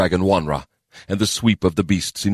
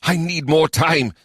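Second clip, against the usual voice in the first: slow, higher insistence; pressing; 10 semitones higher, slower than usual. A narrator's reading. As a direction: lean on the need. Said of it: "Sajay muttered".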